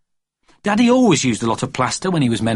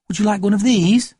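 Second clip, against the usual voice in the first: put-on babysitter offering; 9 semitones higher than usual. A narrator's reading.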